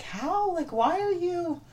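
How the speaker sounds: high, soft mock bewilderment; baffled. Looking at girls in tiny skirts, baffled. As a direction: act out a quiet, high-pitched baffled question. Baffled reaction to skimpy outfits.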